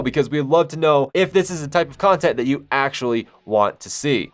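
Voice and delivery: upbeat host outro read, pushing subscribe; youtube outro. Acted. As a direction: sell the subscribe button like a channel host. Channel outro call to action.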